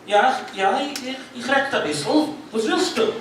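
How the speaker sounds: mild German voice; German-speaking man. Retold or imitated. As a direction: answer in German with a small lift. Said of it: "he says".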